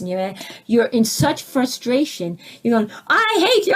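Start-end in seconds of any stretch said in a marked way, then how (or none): 3.1-3.7 high, loud enraged scream; screaming in a fight. Retold or imitated. Spouse screaming at partner in a fight, high furious yell. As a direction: scream it at your spouse in frustration. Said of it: "when you're screaming at your husband".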